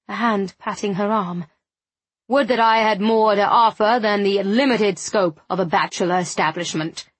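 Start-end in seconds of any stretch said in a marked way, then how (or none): 2.3-7.1 grandly regretful orator, falling away at the end; self-important man making a florid excuse; pompous gentleman. Acted. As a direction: declaim with lofty regret. Narrator voices Mister Tillingham.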